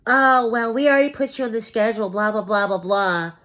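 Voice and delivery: mocking scheduler. Imitating the scheduler brushing him off, high and loud. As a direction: parody the pushy scheduler.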